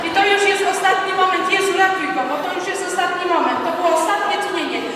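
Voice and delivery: loud, high pleading commentary; urgent narration. Acted. Leader narrating Peter's last moment, urgent and loud. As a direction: shout it as the final moment. Dramatic climax of Peter's drowning.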